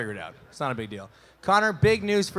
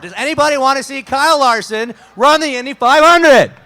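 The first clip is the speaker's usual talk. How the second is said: much louder, higher rallying shout; loud crowd call; 8 semitones higher, 11 dB louder than usual. Real, not acted. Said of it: "Moderately better".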